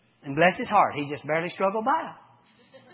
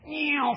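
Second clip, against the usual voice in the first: ball whoosh; 9 semitones higher than usual. Retold or imitated. Imitating the ball flying off.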